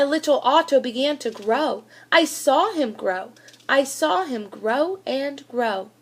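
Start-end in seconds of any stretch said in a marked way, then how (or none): none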